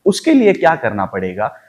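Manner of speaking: ordinary delivery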